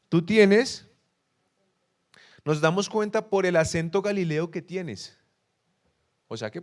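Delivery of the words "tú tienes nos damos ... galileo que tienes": cita acusadora, fuerte y aguda; acusación dramatizada. Retold or imitated. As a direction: proclama la acusación en voz alta. Repite la acusación contra Pedro. (acusación en voz alta)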